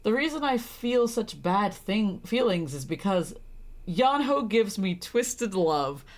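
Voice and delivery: ordinary talk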